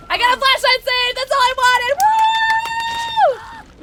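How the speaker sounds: high, loud victory yell; jubilant shout. Real, not acted. Player getting the save she wanted, jubilant. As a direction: yell it in triumph, then whoop.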